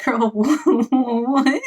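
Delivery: high, baffled laugh through the words; incredulous laughing. Real, not acted. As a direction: crack up at how absurd it is. Host reacts to absurd manga line.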